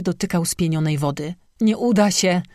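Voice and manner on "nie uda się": mild, higher-pitched despairing moan.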